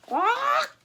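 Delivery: high shrieking quack; shrieked quack. A narrator's reading. Parrot shrieks a warning, high squawk. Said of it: "With a shriek".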